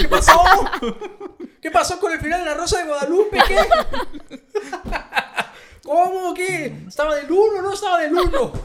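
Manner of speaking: high, loud panicked questions through laughter; worried, frantic. Retold or imitated. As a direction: fret over the ending like it matters. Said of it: "todo preocupado".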